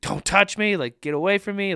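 high, loud mock yell of a frightened kid; retold panicked yell. Pastor replays his eight-year-old self fighting off nurses, high mock yell. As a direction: act out the child pushing the nurses away.

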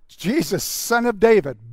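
urgent shouted plea for attention; shouting. Acted. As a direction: call out at full voice to be heard. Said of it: "But he shouted".

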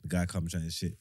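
ordinary talk, nothing marked

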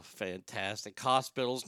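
normal talking voice

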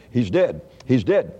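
normal talking voice

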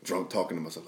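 ordinary talk, nothing marked

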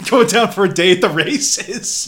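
slow, high and loud toff voice; drawn out like a snooty racegoer; mock posh voice. Joining the posh mockery.

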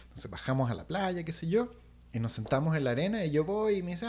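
plain reading voice

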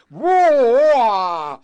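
loud, high blustering "rah"; puffed-up roar. Retold or imitated. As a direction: puff up and bellow like a bully. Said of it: "like the Cheshire Cat".

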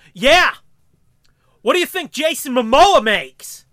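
booming shout out of nowhere; startling loud interjection; sudden loud yell. Retold or imitated. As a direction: blurt it loud enough to blow the mic. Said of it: "protect your ears".

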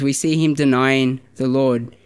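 ordinary talk

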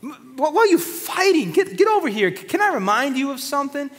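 a fed-up parent breaking up a fight; God calling quarrelling siblings over, high and loud; exasperated parent God. Acted. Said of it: "God is like".

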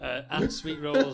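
slow, loud hype man shout. Acted. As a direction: stretch it out like an energy drink ad. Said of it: "Are you this fucking weird hype man now?".

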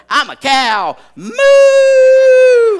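very loud, very high shouted moo. Retold or imitated. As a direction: bellow the moo at full voice. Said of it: "sees an adult ... going".

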